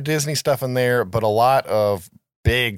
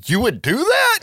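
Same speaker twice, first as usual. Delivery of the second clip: high, louder amazed question; surprised; 14 semitones higher, 4 dB louder than usual. Retold or imitated. Director amazed the actor offers himself, high surprised. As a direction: act stunned by the offer. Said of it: "he's like".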